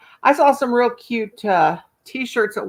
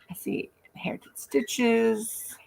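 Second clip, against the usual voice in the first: whisper; 9 dB quieter, slower than usual. Real, not acted. Greeting viewer in a whisper.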